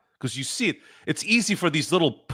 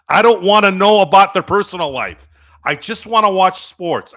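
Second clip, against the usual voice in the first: louder, higher, emphatic protest; angry insistence; 4 semitones higher, 6 dB louder than usual. Real, not acted. Fan fed up with players' personal-life stories, angry. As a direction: hammer it out in exasperation. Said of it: "now you know where the anger comes from".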